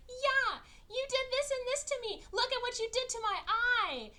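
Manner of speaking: loud, very high-pitched mock tirade; mock outraged accusation. Woman confronting her rescuer at dinner, hammed-up outrage.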